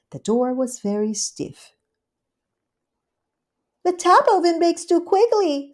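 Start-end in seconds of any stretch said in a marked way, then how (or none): 3.8-5.7 much higher, fussy housewife; Rivy to herself. Acted. Rivy fussing over her ovens, higher and prim. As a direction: mutter the oven worry primly. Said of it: "said Rivy to herself".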